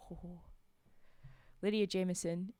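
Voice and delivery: ordinary delivery, nothing marked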